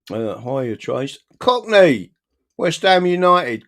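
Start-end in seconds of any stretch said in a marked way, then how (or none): 1.4-3.7 high, loud cheer of the name; excited shout. Real, not acted. Greeting a viewer from the chat, excited shout. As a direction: call it out like a football chant.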